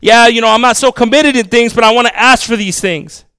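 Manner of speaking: high, loud mocking voice of a lukewarm believer; hammed-up whiny request for prayer; mock churchgoer. Mocking a half-committed churchgoer.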